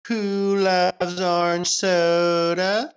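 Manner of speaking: slow, drawn-out, high goofy voice; Good Burger character voice. Retold or imitated. Co-host answers the movie quote in a slow high goofy voice. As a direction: stretch it out like the dopey cashier. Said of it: "Good Burger quote".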